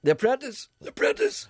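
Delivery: high, loud mock shouting; yelled impression; Gregory Campbell. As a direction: holler it, hammed-up. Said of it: "for Gregory".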